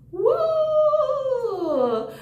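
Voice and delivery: high excited whoop; quick high cheer for the authors. Real, not acted. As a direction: whoop with delight. Cheering the book creators.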